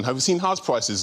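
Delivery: ordinary delivery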